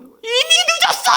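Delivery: put-on high, loud wailing child's shout; mimicking a toddler's wailing protest; child's tantrum shout. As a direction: yell it like a furious small child. Child crying, refusing to eat.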